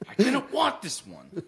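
high, drawn-out mock complaint with laughter; joking disappointed mom. Joking that mom hates her kid, mock complaining.

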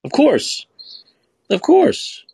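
high-pitched mock insistence; exaggerated squeaky-high "of course", dripping with sarcasm; sarcastic agreement. Sarcastic mock denial of vaccine link.

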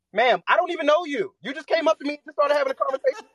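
high, fast, loud disbelief; sky-high and rushed like you can't believe this stranger; incredulous. Retold or imitated. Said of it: "it was like".

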